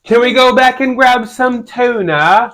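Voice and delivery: high, drawn-out British impression; mock British accent. Man mimics his partner at the store, mock British accent. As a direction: tease with a slow, high British voice. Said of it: "She'll say things at the store".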